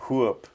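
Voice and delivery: "Whoop" said the East Tennessee way; Tennessee pronunciation. Shown as a demonstration. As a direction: imitate a Tennessean saying "Whoop". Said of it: "he taught me how to say".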